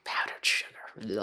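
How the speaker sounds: slow, hushed whisper of the words; mock whisper. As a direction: breathe it out like a sly secret. Mocking the fancy rim idea.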